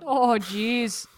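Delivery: loud mock-Minnesota echo; hammed-up Minnesota vowels; Minnesota accent. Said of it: "That sounded very Minnesota".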